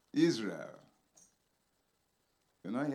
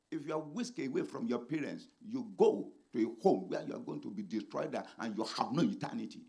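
ordinary delivery

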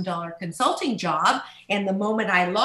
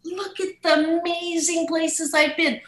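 delighted reminiscing; 6 semitones higher than usual. Retold or imitated. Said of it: "I go".

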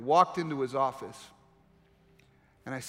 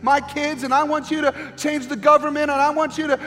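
much higher and louder, driving, almost shouting the list; preaching at full force; 9 semitones higher, 9 dB louder than usual. Acted. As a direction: pile up the demands in a rising preacher's roar. Preaching climax, imitates dumping requests.

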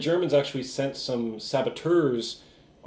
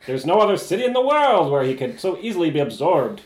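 loud, raised, hammed-up Mid-Atlantic accent; old-timey Mid-Atlantic accent; 4 semitones higher, 7 dB louder than usual. Reading a tip letter to the FBI, hammed-up old-timey Mid-Atlantic accent. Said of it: "old-timey Mid-Atlantic accent".

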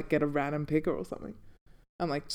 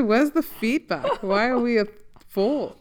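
indignant mock question; 6 semitones higher, 3 dB louder than usual. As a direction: act outraged at the rating. Imitating own reaction, higher.

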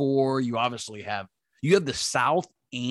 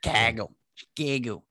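Chicago accent, flattened A in "Chicago". Shown as a demonstration. Host shows co-host the weird local letters, Chicago accent. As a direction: say "Chicago" like a local, with odd vowels. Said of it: "They throw, like, weird letters in".

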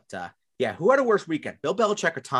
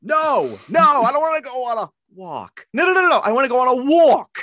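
much higher and louder, with a New York walk; a hammed-up, loud New York protest; a loud, high, mock New York voice; New York rant; 11 semitones higher, 8 dB louder than usual. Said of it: "acted New York outburst".

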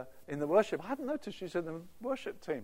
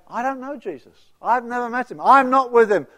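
loud heated denial; angry denial; 7 semitones higher, 16 dB louder than usual. Acted. Peter denies knowing Jesus to accusers, loud furious denial. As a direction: shout the denial, cornered and furious.

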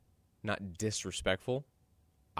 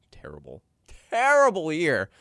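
disgusted; 7 semitones higher, 8 dB louder than usual. Real, not acted. Disgust at Cowboys' season.

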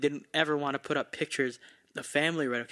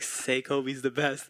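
very slow, pressing command; lightly voiced coworker, slow and pressuring; slow insistent demand; much slower than usual. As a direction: lean on each word, make him say it. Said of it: "He's like".

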